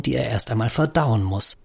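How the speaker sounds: plain reading voice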